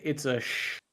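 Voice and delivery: ordinary delivery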